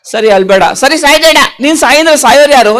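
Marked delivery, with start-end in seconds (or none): none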